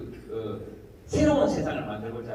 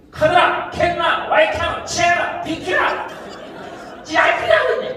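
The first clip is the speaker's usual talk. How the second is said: loud, much higher Gyeongsang dialect bursts; punchy Gyeongsang words; 7 dB louder, slower than usual. Shown as a demonstration. Comedian listing Gyeongsang dialect words, loud piercing bursts. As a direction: fire each Gyeongsang word off hard and high. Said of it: "귀에 전부 다 꽂히는 소리".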